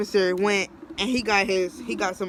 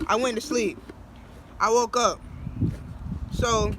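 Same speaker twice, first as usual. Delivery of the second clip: normal talking voice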